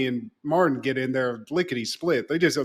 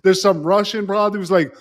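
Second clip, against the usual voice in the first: ordinary talk, nothing marked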